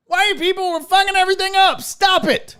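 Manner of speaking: high, strained comic outrage; worked-up, high-pitched telling-off; exasperated rant. Acted.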